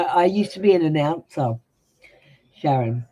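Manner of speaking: normal talking voice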